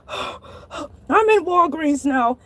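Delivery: acted panting between words; catching breath. Shark reaching the drugstore after the chase, out of breath. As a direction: sigh heavily, then speak slowly, winded. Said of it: "trying to catch my breath".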